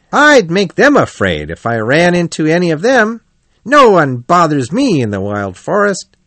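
boast, then order little Mole about cheerily; confident Otter character. Acted.

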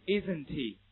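"Isn't he" run together with the h weakened into the link; linking through h. Shown as a demonstration. Teacher drilling h linking for students, linked reading. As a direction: model "Isn't he" as one joined unit. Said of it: "永远不能阻止连读的发生".

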